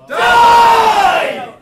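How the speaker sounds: huge roaring shout. Pretend. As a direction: bellow it at full force. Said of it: "quick practice of that for me".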